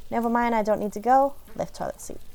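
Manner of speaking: ordinary talk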